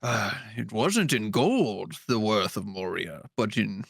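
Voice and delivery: low, slow wizardly impression; old sage unveiling lore, drawn out and hushed at the end; Gandalf. Wizard telling the party of Moria's wealth, solemn storyteller. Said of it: "some good backstory from Gandalf".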